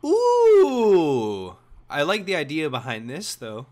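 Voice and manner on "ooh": excited exclamation. Real, not acted. Excited reaction during game.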